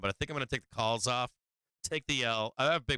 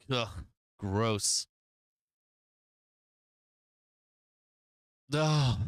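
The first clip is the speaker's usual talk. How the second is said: annoyed ugh; much slower than usual. Real, not acted. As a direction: groan it out in disgust. Disgusted at losing trade.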